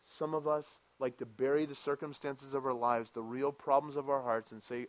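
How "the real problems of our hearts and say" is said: ordinary talk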